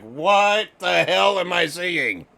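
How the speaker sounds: high, drawn-out mock dismay; cringing disbelief. Co-host recalling his reaction to the segment, high slow cringe. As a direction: stretch it out in appalled disbelief. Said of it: "I was just cringing".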